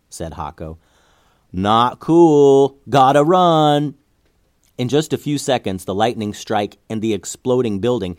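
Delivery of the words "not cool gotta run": loud, raised voice, last words drawn out; urgent call. A narrator's reading. Hako urges the group to flee cannon fire, loud drawn-out call. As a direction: call it out high and stretched.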